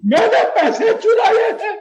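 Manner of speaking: high-pitched, agitated protest; wounded, high outcry; indignant outburst. Acted. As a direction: spit out the accusation in disbelief. Accused father bursts out.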